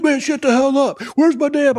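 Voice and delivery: hammed-up furious squeal; mock angry high voice. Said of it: "he was so mad".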